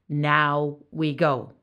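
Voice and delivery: weighty and unhurried demand; stern command. Acted. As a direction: issue a slow, final command. Death, furious, confronts the tinker.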